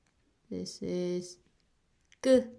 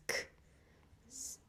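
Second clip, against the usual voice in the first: whispered k; 14 dB quieter than usual. Shown as a demonstration. Teacher whispering the k sound to show it. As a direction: whisper "This k" with breath only. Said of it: "is more like k".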